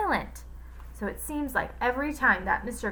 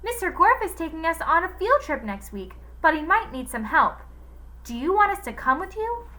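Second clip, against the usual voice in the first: put-on higher little girl's voice; 8 semitones higher, 4 dB louder than usual. A narrator's reading. Villain faking the girl's voice to the cook, higher. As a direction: mimic a sweet schoolgirl. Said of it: "using Allison's voice".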